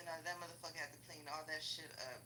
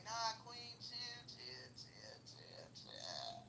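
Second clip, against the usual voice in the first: soothing calm-down; 8 dB quieter, much slower than usual. Retold or imitated. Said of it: "the brother came and was like".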